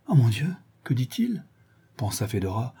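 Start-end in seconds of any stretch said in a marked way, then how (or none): 0.1-1.4 higher, anxious inward exclamation; troubled thought. A narrator's reading. Fedora thinking as Ivan blunders, troubled. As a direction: fret inwardly, alarmed. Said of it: "à peine remise de son trouble".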